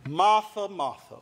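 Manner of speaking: ordinary delivery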